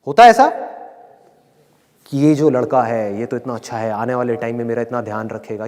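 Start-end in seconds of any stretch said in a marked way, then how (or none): 2.2-5.7 low, softened daydreaming voice; imitated dreamy hopefulness. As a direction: mimic a smitten, hopeful daydreamer. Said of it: "अपने सपनों के महल खड़े कर लेते हैं".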